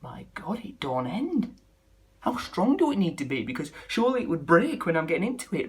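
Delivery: normal talking voice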